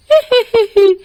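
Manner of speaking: high, loud taunting witch cackle; evil cackle. Acted. Witch taunting Suraj before she leaves, high cackle. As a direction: throw back a wicked cackle.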